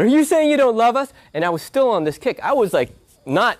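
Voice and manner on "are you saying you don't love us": sister's upset question. Retold or imitated. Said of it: "she said".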